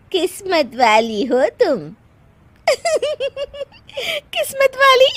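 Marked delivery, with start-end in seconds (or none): 2.7-5.2 high incredulous echo between bursts of giggles; laughing. Acted. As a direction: repeat it as a question and crack up. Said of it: "हंस क्यों रही हो". (हंसते हुए ऊंची आवाज़ में दोहराना)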